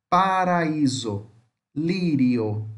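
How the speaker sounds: teacher's careful model of tapped R in Paraíso, lírio; tapped R demo. Shown as a demonstration. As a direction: say Paraíso, lírio slowly, flicking each R with the tongue tip. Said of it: "Esse é o som de R".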